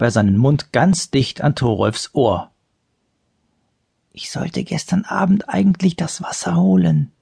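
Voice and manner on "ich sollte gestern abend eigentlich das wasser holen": secret whisper close to the ear. A narrator's reading. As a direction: whisper so only he hears. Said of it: "sagte er so leise".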